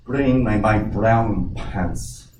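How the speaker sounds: very slow, drawn-out order; deadpan captain's punchline. Acted. Captain tells first mate the punchline, very slow deadpan. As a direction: drag out the punchline dead serious. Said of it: "first mate and says".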